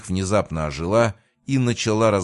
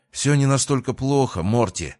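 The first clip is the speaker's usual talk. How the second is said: exhausted, breathless blurting; 6 semitones higher, 3 dB louder than usual. A narrator's reading. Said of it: "задыхаясь от усталости, выпалил Джокер".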